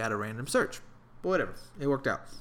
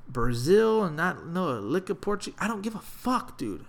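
ordinary talk

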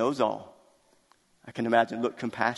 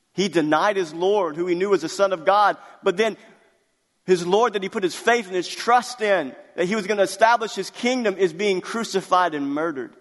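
preaching at full force; 6 semitones higher, 3 dB louder than usual. Acted. Preaches to the congregation.

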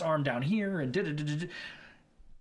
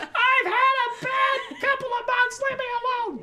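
very high, drawn-out mock wail; hammed-up distraught tree dweller; 3 dB louder, slower than usual. Worn-out teacher in a tree to the FBI, mock wailing. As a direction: wail it slowly in a squeaky, hammed-up voice. Acting arrested man in the tree.

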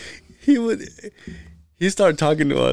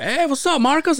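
1 cheery greeting; 11 semitones higher than usual. Retold or imitated. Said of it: "and he's like".

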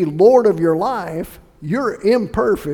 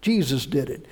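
normal talking voice

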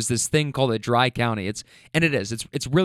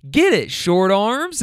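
slow, higher mock jeer; teasing heckle; 5 semitones higher, 4 dB louder, slower than usual. Wife ribbing husband at the car door, teasing heckle. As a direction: egg him on teasingly, drawing it out.

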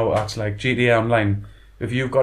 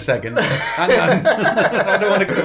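laughing, rising panic; laughing mock alarm; 4 semitones higher, 3 dB louder, slower than usual. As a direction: giggle through it, backing off. Retold alarm at pushy players.

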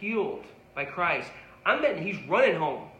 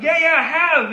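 much higher, louder eager agreement; eager yes; 9 semitones higher, 10 dB louder than usual. Retold or imitated. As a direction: blurt it out keen. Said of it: "And the guy says".

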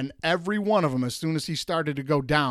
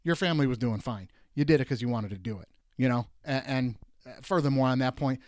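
ordinary delivery

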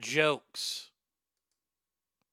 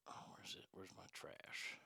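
muttered whisper; 12 semitones lower, 32 dB quieter than usual. Real, not acted. Muttering to himself.

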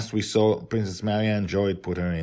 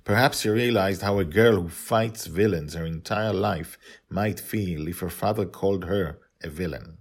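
usual speaking voice

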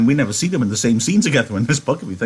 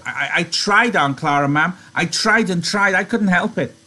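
high-pitched pleading Lenny; higher, stammering protest; 6 semitones higher than usual. A narrator's reading. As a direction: plead like a scolded child.

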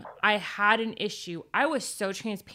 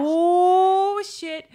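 sudden high, loud "Oh" then a dropped "shit"; startled realization; 9 semitones higher, 8 dB louder than usual. Retold or imitated. Guest recalling her reaction to the advice, startled. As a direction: caught off guard by the truth, blurting it out. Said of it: "I was like".

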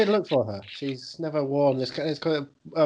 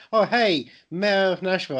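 higher-pitched mock greeting to the mayor; 6 semitones higher than usual. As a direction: play up the grand hello, pitched high. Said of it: "no, no, not".

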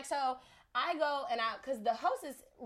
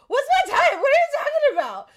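much higher, loud disbelieving yell with a laugh in it; outraged shout; 15 dB louder than usual. Retold or imitated. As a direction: blast it out in shocked indignation. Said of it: "So I'm like, "What?" Like, immediately".

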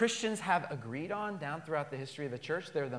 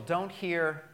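usual speaking voice

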